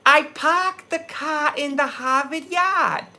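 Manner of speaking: high, slow mock Boston accent. Outsiders mocking his New England roots, high slow Boston accent. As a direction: drop every R like an outsider faking Boston. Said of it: "find out I'm from New England".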